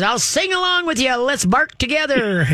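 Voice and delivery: high, sing-song talking dog; gleeful dog. Retold or imitated. Trainer voices dog joining the barking, high and sing-song. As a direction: pitch it way up as an excited dog joining in. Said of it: "pretty soon the dog is".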